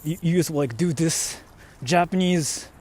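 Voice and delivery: normal talking voice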